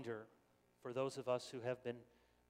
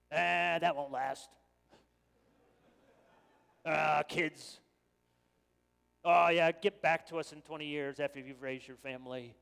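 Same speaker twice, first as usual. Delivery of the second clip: put-on cynical old couple; 11 dB louder than usual. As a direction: scoff at the young lovers like a jaded veteran. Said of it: "seasoned couple says something really helpful like".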